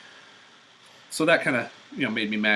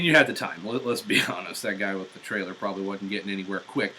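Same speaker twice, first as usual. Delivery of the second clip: ordinary talk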